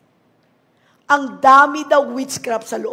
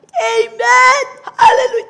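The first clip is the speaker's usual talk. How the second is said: loud shout; 13 semitones higher, 10 dB louder than usual. Shown as a demonstration. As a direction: shout Amen and Hallelujah, high and loud. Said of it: "pinakamalakas sumigaw ng". (malakas na sigaw ng papuri)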